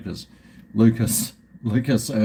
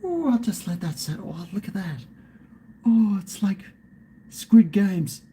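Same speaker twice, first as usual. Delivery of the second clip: delighted; 6 semitones higher than usual. Real, not acted. Delighted watching beer settle.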